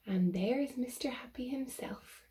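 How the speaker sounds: soft unvoiced aside; whisper. Real, not acted. Whispering to the child listener, hushed.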